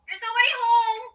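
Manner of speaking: high, loud singsong call. Acted. Wendy answers the knock on the door, calling out. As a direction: holler it towards the front door. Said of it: "Wendy called".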